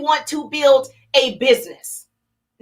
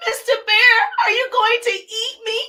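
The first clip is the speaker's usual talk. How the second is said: put-on higher-pitched, plaintive little boy; lightly voiced little boy, pitched up; 6 semitones higher than usual. Little boy asking the bear about being eaten, higher plaintive child voice. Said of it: "And the little boy said".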